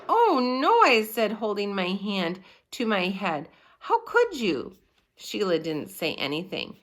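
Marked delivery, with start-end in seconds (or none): 0.1-0.8 high, loud "oh no" of dismay; dismayed cry. A narrator's reading. Narrator voices dismayed boy. 3.8-4.8 high-pitched reproach; hurt, accusing question; outraged. A narrator's reading. Boy reproaches classmate.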